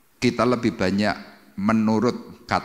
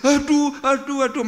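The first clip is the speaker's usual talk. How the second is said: pained crying out; 4 dB louder than usual. Retold or imitated. Sinners in hell struck by fiery stones, pained cries. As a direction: wail as if struck by burning stones. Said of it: "Orang hanya bisa".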